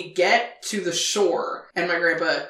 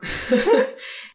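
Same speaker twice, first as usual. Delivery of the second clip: laugh. Real, not acted. Laughing at own story.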